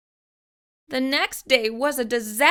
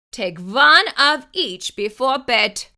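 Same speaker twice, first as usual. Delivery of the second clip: doctor instruction; 4 semitones higher, 8 dB louder than usual. Acted. Specialist voiced in story.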